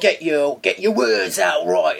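a light Cockney voice, slow and punchy; vigorous Cockney. Retold or imitated. Showing a friend the mouth workout of Cockney, lightly voiced. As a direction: chew the words in a lively Cockney. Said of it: "a bit of a mouth workout".

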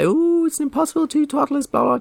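high squeaky mocking whine; a silly high-pitched moaning voice; mock whiny complainer. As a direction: mimic a fussy moaner, way up high. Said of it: "those of you out there who say".

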